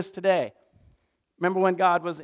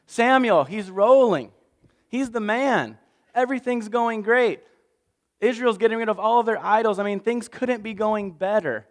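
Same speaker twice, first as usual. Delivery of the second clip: excited storytelling; 5 semitones higher, 4 dB louder than usual. A narrator's reading. Samuel's success told excitedly.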